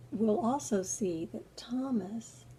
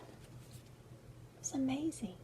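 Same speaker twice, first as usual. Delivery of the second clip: awed whisper; 4 semitones higher, 12 dB quieter, slower than usual. Acted. Awe at the empty tomb.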